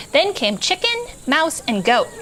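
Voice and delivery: ordinary delivery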